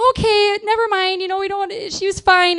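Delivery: high, loud imitated retreat; exasperated backing off. Cop giving up on the screaming mom, exasperated.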